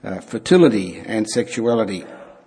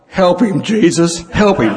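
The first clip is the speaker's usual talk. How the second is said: mock cry for help; 5 semitones higher than usual. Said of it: "And she cried out".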